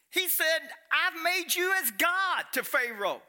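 loud, high, driving sermon voice; full-force preaching. Acted. Preacher rousing the congregation, loud and high.

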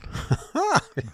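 short, high burst of laughter; high involuntary chuckle; scoffing laugh. Real, not acted. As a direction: laugh in disbelief at what was just read. Laughs at the article's claim.

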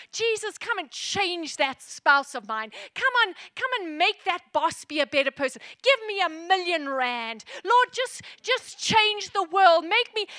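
loud, pleading wish-list prayer, slowed on the big asks; hammed-up greedy prayer to Jesus; mock demanding prayer. Preacher mimics Christians praying for benefits, loud mock plea.

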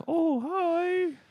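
very high, silly put-on voice; mock puppet greeting. Talking through a hand to customers, high squeaky greeting. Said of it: "it might look, like, sarcastic".

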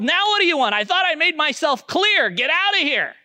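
high, loud, irritated shout; angry yell. Retold or imitated. Pub lady yells at the returning homeless man, furious. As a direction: bark it at the beggar at the door. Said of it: "And she yelled".

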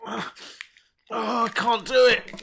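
strained high cry of effort; straining to tear it open. Real, not acted. Struggling to tear foil.

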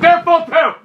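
high, loud clerk's shout; explosive mock yell. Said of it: "why are you yelling".

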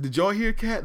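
high disbelieving question to the room; mock shock asking the adults; incredulous. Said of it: "I said".

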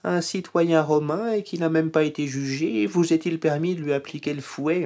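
high, mock-innocent ironic question. Paul, bound, ironically asking the centurion, voiced high. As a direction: ask it with a sly, pointed irony. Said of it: "sans doute un peu ironique".

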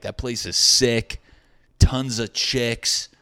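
hammed-up bro sales pitch; bar-dude hype. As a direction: imitate a dude selling a place to his buddies. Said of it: "this is what they were telling dudes at the bar".

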